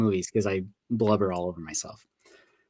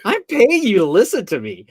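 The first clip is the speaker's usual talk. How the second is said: indignant disbelief; 7 semitones higher, 4 dB louder than usual. Retold or imitated. Retold indignant protest to therapist.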